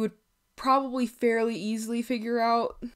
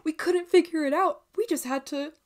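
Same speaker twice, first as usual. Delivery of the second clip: higher, lightly hammed-up mock scientists; mocking imitation; 6 semitones higher, 4 dB louder than usual. Vlogger imitating baffled scientists, mocking. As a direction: imitate clueless experts, pitched up.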